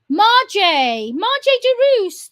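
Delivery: slow, loud accusing call of a name. Real, not acted. Calling out named ambassador.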